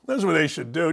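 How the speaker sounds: usual speaking voice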